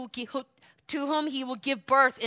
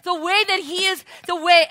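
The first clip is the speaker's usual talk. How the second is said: usual speaking voice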